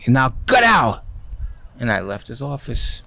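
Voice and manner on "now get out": imitated angry shout of dismissal; sudden mock yell; screaming boss. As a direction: throw someone out of the office, loudly. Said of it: "Steinbrenner screamed at me".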